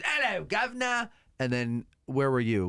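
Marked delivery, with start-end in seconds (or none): 0.0-1.1 high, hammed-up Cockney greeting; cheery London cabbie hello; mock Cockney cabbie. Host showing the cab driver's Cockney, high and hammed-up. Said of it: "And then the cab driver's".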